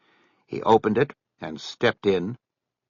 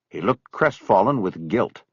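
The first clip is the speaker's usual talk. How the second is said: ordinary delivery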